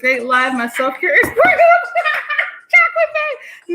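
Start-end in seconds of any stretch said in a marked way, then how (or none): 1.1-3.7 high, laughing through the name. Real, not acted. Teased by a viewer about self-care, laughing high. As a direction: crack up mid-sentence.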